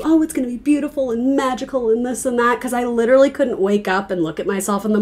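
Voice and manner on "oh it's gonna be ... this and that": airy mock reassurance; sugarcoated fortune-telling. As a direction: lightly mimic a reader sweet-talking a client. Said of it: "I can't tell you".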